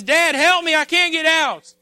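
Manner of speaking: high, loud plea for rescue; begging son. Acted. Son trapped in a pit begs his dad, pleading loudly. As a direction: call up to Dad for help, desperately. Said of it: "he's pleading and he's begging".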